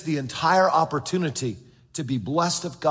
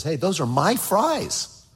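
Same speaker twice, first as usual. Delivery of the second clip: slow, grudging mine-mine voice; slow, possessive mock complaint; possessive attitude; slower than usual. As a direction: drag it out like a stingy child. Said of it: "this attitude towards God that says".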